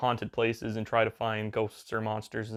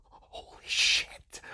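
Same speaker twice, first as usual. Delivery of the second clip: awed whisper; much slower than usual. Retold or imitated. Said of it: "the kid would be like".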